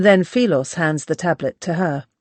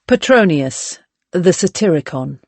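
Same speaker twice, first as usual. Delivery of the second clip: ordinary delivery, nothing marked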